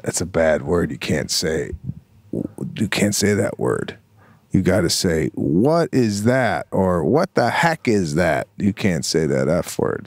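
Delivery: low, calm dad explaining; gentle parental correction. Retold or imitated. Father correcting daughter's swearing, gently. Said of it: "and I go".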